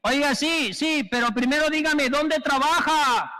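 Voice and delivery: high, loud, unhurried question; mock questioning. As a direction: calmly ask his name over the cries. El señor pregunta dónde trabaja. (voz aguda y pausada, preguntando el nombre)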